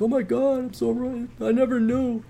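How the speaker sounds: high, soft mock amazement; hushed-level, high-pitched mock epiphany; imitating amazed reader. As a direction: play a fan having a revelation, high-pitched. Acting out readers' happy realisation.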